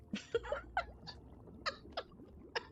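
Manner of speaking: airy high giggle; breathy laugh. Real, not acted. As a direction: burst into a silent laugh. Laughs at own joke.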